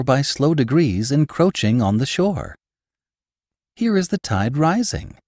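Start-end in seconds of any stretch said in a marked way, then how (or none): 3.8-5.2 much higher delighted exclamation; cried out. A narrator's reading. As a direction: call it out with wonder. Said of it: "I cried".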